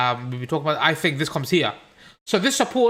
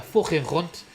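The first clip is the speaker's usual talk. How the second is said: Scouse with throaty, scraped k sounds; Liverpool accent; slower than usual. Shown as a demonstration. Streamer proving he can change accents, demo Liverpool accent. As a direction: put on a quick Scouse accent. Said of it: "I can do a Liverpool one".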